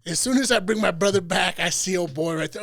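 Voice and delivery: usual speaking voice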